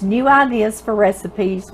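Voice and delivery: normal talking voice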